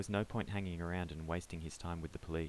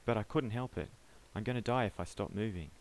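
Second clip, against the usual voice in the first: breathless; 4 semitones higher, 4 dB louder than usual. Acted. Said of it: "Peter said breathlessly".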